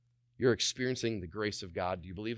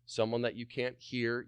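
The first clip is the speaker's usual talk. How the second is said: ordinary talk, nothing marked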